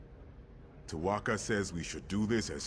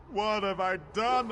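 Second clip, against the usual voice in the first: anguished cry; 14 semitones higher, 5 dB quieter than usual. Acted. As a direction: cry it out in despair. Repeated lament, rising.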